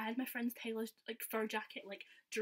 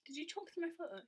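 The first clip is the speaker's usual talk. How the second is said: high-pitched retold question; mock affronted; 8 semitones higher than usual. Fan challenging performer who talked during the photo, mock affronted.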